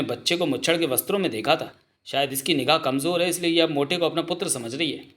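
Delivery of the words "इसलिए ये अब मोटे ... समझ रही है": ordinary delivery, nothing marked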